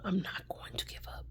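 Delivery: soft unvoiced whisper; faint whispered vow. Real, not acted. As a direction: whisper it to yourself.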